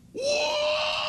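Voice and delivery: high, loud yelp; mock cry of pain. Stepping on a rusty nail, a high loud yelp. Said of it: "you'll go".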